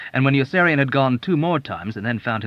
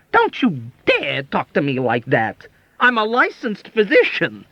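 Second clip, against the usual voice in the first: indignant; 8 semitones higher, 5 dB louder than usual. A narrator's reading. Doctor indignant, wrenching away.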